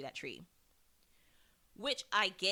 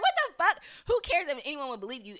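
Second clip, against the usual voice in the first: much higher and louder, incredulous yell; mock outraged shout; 7 semitones higher, 7 dB louder than usual. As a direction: bark it at her in disbelief. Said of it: "And they're like".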